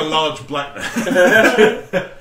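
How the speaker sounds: high, loud, very slow laughing voice; mock Omer. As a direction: ham up the pushy interrupter, laughing. Voicing Omer barging in.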